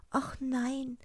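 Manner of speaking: high, whispery princess; hushed high girl's voice; frightened princess. Acted. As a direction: answer small and high. Princess answers her father.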